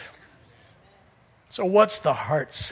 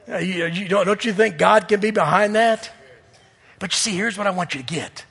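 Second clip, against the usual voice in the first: ordinary delivery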